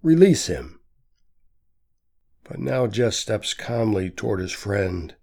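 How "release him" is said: a higher, louder command; shouting. A narrator's reading. Pete orders the priests to free Jess, shouting. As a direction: bark the demand at the leaders. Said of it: "shouts Pete".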